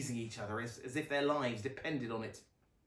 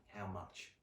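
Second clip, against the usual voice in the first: put-on much softer, lower girl; small quiet question; 5 semitones lower, 13 dB quieter than usual. A narrator's reading. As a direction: Luna asks her parents softly, hushed. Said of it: "Luna asked".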